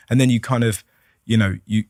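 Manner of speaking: usual speaking voice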